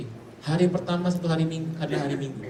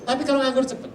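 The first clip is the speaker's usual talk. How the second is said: much higher, louder emphatic cry; excited exclamation; 9 semitones higher, 6 dB louder than usual. Real, not acted. Preacher to congregation, a sudden excited high cry. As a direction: throw it out with a burst of excitement.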